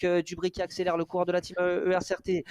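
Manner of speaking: ordinary talk, nothing marked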